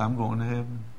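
soft, hushed retold assurance; tearful smile. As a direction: imitate a dying man quietly sure. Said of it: "smiled at me with a tear".

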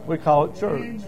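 ordinary talk

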